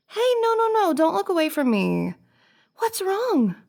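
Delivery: high-pitched, worried coaxing; urgent concern. Acted. To a crying friend who looks away, urgent concern. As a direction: plead urgently for their attention.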